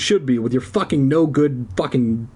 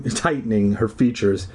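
ordinary delivery, nothing marked

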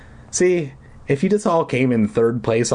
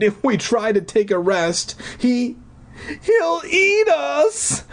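high slow crying shout; terrified cry; 14 semitones higher, slower than usual. Acted. Deirdre crying out that the monster eats them.

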